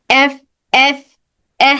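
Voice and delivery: airy f for ایف; sound demo. Shown as a demonstration. Teacher demonstrating airflow to class. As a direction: let air flow through ایف. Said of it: "اس طرح سے".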